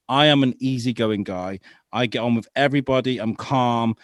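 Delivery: normal talking voice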